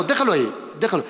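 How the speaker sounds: ordinary talk, nothing marked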